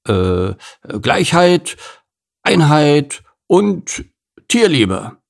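speechifying gorilla. Acted. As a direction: address the crowd with pomp. Gerhard addresses the assembly.

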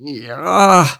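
drawn-out, reluctant high "ja"; hesitant cow character; hemming and hawing. A narrator's reading. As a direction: squirm through the word, stalling. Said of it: "druckste Kuni".

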